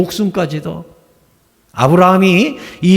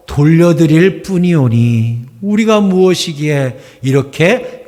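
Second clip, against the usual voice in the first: slow, low reverent prayer; solemn prayer; 3 semitones lower, slower than usual. Retold or imitated. Pastor reading David's reverent prayer slowly. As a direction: intone it slowly like a temple prayer.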